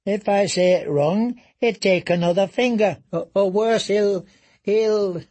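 panicked stammer. Acted. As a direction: stammer in dread of losing a finger. Reek panics about punishment.

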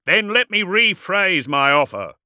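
high, loud projected warlord voice; calling out. A narrator's reading. Warlord rephrases his offer to the dreadnought, calling out. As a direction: project it across open ground. Warlord answers across battlefield.

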